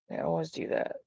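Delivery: under-the-breath whisper. Real, not acted. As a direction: whisper it in frustration. Muttered aside to herself.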